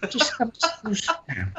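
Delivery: high, mock-friendly Cockney threat; Cockney menace. Shown as a demonstration. Villain seating a victim, high friendly Cockney menace. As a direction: coax politely with Cockney menace.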